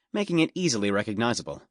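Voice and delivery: ordinary delivery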